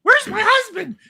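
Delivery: very high, loud piping question; a dizzy wife calling out; hammed-up high woman's voice; shrill wife. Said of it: "she comes out and goes".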